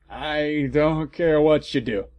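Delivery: ordinary talk, nothing marked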